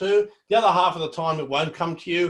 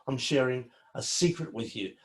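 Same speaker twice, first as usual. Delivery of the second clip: softer, lower, confiding delivery; sharing a secret; 4 semitones lower, 6 dB quieter than usual. Shown as a demonstration. Presenter shows students how to vary volume, sharing a secret. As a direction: draw them in quietly, as if confiding. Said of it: "I can get softer".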